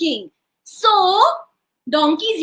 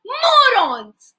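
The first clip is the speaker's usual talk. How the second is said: slow, high, stretched insult; hammed-up scolding; scolding wife; 4 semitones higher, 4 dB louder, much slower than usual. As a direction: draw it out to shame the crowd. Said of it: "That is why I said".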